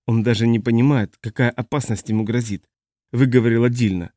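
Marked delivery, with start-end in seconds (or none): none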